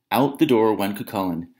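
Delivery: plain reading voice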